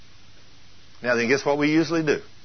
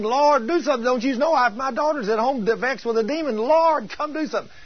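much higher, insistent mock plea; begging; 10 semitones higher than usual. Preacher acting the mother who keeps at Jesus for her daughter, frantic begging. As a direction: keep pleading urgently, pitch way up. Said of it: "you re-, she's begging".

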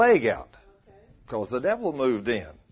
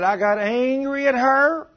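high, slow and louder, drawn out; acted indignation; 10 semitones higher, 5 dB louder, slower than usual. Preacher retelling a man's quarrel, acted indignation. Acting out man's angry confession.

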